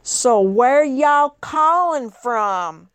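slow, drawn-out Southern drawl imitation; Southern accent. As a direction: mimic the clerk's Southern accent lightly. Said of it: "I can hear his accent".

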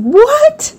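startled squeak of disbelief; shocked what. Retold or imitated. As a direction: jump up in disbelief at the price. Shocked at the fifty dollar fee.

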